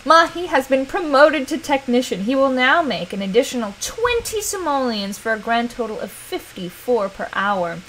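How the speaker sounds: normal talking voice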